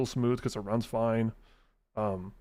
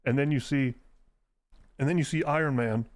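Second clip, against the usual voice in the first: ordinary talk, nothing marked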